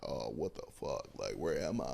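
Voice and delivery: dazed freshman whispering to himself; shell shocked. Retold or imitated. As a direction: mutter it like you can't believe where you are. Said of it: "I'm just shell shocked".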